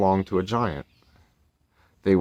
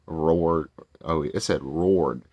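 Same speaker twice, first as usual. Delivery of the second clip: ordinary delivery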